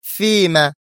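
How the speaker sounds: high, challenging one-word question; defiant demand, pitched well up; stern, defiant. A narrator's reading. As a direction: throw down the challenge sharply. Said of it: "سألته في صرامة متحدية".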